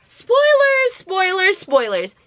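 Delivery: high-pitched alert, pitched well above normal; high spoiler warning. Real, not acted. Sing-song warning of plot spoilers.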